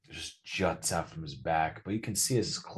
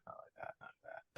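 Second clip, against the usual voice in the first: fading to whisper; 13 dB quieter, faster than usual. Acted. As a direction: trail off to a whisper. Repeating, fading to nothing.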